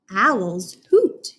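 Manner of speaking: ordinary talk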